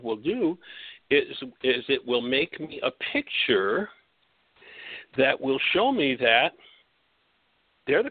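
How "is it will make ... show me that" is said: ordinary delivery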